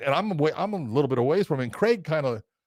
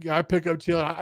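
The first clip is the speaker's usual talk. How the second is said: ordinary delivery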